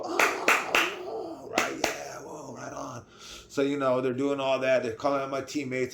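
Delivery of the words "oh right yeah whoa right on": high, whooping cheer for a teammate; excited cheering. Retold or imitated. Proud teammate cheering each promoted teammate, excited whoops. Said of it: "I'm over here yelling out their names".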